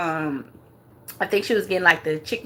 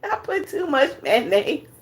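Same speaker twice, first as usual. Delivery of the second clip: laughing imitation; 5 semitones higher, slower than usual. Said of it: "She was like".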